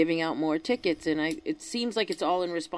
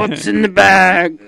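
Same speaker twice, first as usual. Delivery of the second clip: shouted retort; 13 dB louder, slower than usual. Acted. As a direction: shout it out, much louder. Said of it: "none of your uncle's business".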